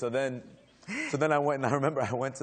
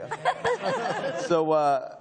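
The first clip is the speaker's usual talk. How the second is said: laughing; 7 semitones higher, 6 dB louder, much slower than usual. Real, not acted. Laughing mid-story.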